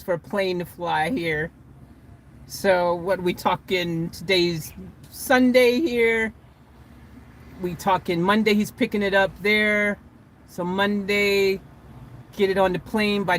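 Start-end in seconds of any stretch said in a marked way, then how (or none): none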